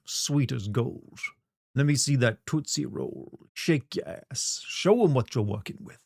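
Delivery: mock-sinister Wesker accent, drawn out. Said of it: "I'll do my best Wesker accent".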